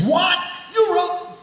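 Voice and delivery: jumping out of his chair in amazement; astonished outburst. Retold or imitated. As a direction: leap up in disbelief. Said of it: "[name] jumps out of his chair".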